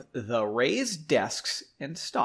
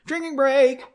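high, loud call-out; called announcement; 11 semitones higher, 5 dB louder than usual. Pretend. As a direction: sing out the break loud and high. Calling out the drinking break.

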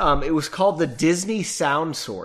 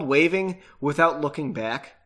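ordinary delivery, nothing marked

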